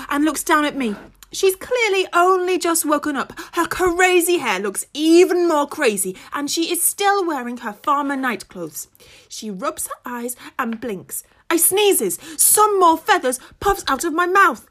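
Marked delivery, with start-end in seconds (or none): none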